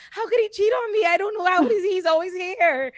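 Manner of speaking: high-pitched jealous wailing in jest; mock betrayal. Mock jealousy over Tyler.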